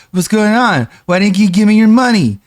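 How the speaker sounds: high, loud imitation of a begging streamer; mock streamer rant. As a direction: ham up a greedy streamer's demand for money. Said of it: "He's like".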